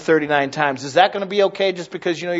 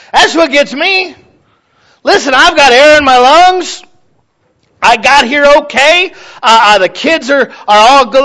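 louder and higher, bursting with gratitude; fervent preaching; 6 semitones higher, 6 dB louder than usual. Acted. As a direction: preach it out, almost crowing with thanks. Preaching with full force.

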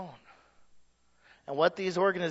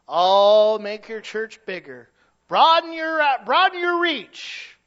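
mock sales pitch; 5 semitones higher, 14 dB louder, slower than usual. Mocking convention sales pitch.

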